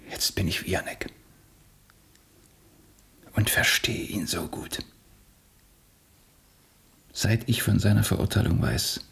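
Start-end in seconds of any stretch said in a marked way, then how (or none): 0.1-4.8 hushed, whispered confession. Acted. Alexis whispers that he understands Jannik. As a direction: say it under your breath.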